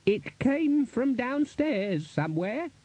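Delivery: high, drawn-out character voice; the Chair's voice. A narrator's reading. Wizard chair after a scream downstairs, high slow voice. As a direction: stretch the words slowly, high in pitch.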